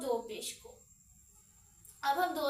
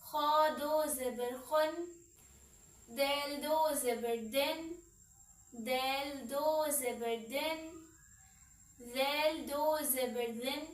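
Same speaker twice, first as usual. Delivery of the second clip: slow drill; slower than usual. Shown as a demonstration. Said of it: "इसे हम खे नहीं पढ़ें".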